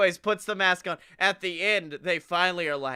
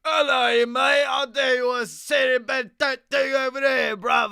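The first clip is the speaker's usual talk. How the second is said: loud, high, shouted thick Cockney; over-the-top Cockney; 8 semitones higher, 6 dB louder than usual. Acted. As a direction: bellow it like a heist-movie Cockney thug. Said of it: "the violently over Cockney".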